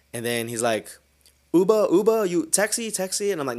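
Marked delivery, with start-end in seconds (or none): 1.5-3.3 loud, high-pitched mock tourist calls; drunk stranger hailing. Drunk European calls to a driver for a taxi, high insistent hailing. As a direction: call out for a cab, high and insistent.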